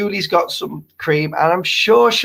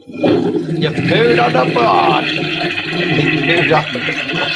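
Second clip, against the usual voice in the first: mock scolding; 2 semitones lower, slower than usual. Scolding the dog.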